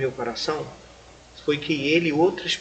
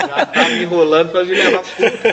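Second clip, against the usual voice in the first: laughing through words; 5 semitones higher, 11 dB louder than usual. Retold or imitated. Laughing at the church invitation.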